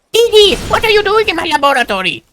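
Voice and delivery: put-on very high, whiny boy genius; Dexter voice. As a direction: whine it like an outraged kid scientist. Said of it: "Doctor Doofenshmirtz sounds like".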